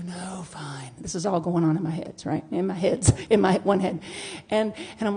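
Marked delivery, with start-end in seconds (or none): 0.1-0.9 softer, grudging give-in; grudging concession. Retold or imitated. Answering her own question in her head, soft grudging concession. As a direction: concede reluctantly. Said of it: "Are you absolutely sure".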